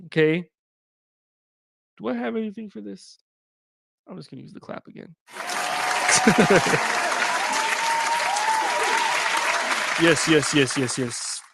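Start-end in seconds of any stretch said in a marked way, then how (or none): 5.4-10.0 a long, squealing high-pitched laugh. Real, not acted. Laughing at his own clap sound effect, long high laugh.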